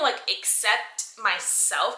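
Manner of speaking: ordinary talk, nothing marked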